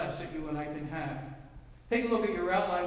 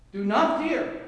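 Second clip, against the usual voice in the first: louder, higher, firm command; commanding imperative; 4 semitones higher, 7 dB louder, slower than usual. Shown as a demonstration. Preacher declares God's command to the church, loud and firm. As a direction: proclaim it as God's order. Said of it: "It's in the imperative".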